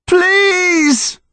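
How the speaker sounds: high desperate shout; panicked yell. Acted. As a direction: scream to be let out. Desperate plea.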